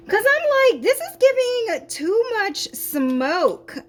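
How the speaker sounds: high, loud and slow; alarmed. Real, not acted. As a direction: exclaim in flustered alarm. Alarmed at sugar cloud.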